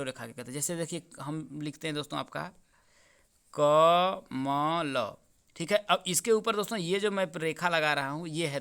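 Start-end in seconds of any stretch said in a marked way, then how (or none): 3.6-5.1 कमल very slowly, split क, म, ल; drawn-out dictation of कमल; syllable by syllable. Shown as a demonstration. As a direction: stretch each syllable of कमल, as if writing it. Spells word while writing it.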